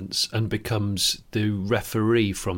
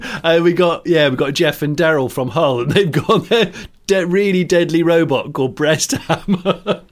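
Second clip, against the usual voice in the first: mock host intro; 4 semitones higher, 5 dB louder than usual.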